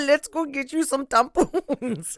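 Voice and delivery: slow, high mock French accent; crappy French accent. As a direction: lay on a silly French accent. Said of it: "That was my really crappy French accent".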